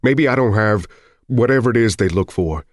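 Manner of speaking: acted exasperated husband; heated retort. A narrator's reading. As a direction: argue back hard. Husband arguing back in the quarrel.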